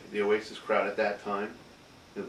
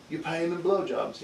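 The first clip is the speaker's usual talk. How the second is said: lightly mimicked Mancunian, pitched higher; mock a Mancunian lad's accent; 7 semitones higher than usual. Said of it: "we said with that Mancunian fucking accent".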